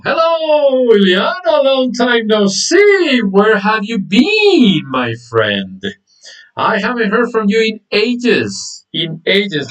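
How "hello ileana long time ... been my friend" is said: high, slow, drawn-out excited welcome; excited greeting. Real, not acted. Teacher to a long-absent student, excited greeting. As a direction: call out high, stretching the words in delight.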